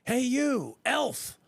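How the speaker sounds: high, loud mock-outraged demand; hammed-up shouting at a stranger; retold yell. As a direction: holler it pompously down the street. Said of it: "I yelled".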